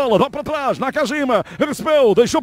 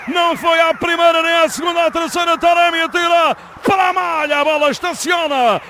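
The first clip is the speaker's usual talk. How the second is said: excited shouting commentary; 3 semitones higher than usual. Acted. Goal recap at full voice.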